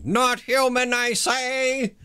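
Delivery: high, pompous witch hunter exclamation; Victor Saltzpyre. Acted. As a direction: declare it like a pompous zealot. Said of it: "Everybody is Victor Saltzpyre today".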